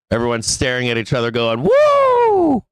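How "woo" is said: very high, loud cheering woo; excited whoop. Retold or imitated. Traders cheer at each other, loud high whoop.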